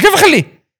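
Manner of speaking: high, loud outraged question; mock outraged teen; indignant disbelief. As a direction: squeal it in disbelief. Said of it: "قال".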